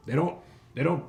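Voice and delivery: loud, scornful hobgoblin; callous hobgoblin boss. Acted. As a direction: brush it off with contempt. Hobgoblin mocks question of souls.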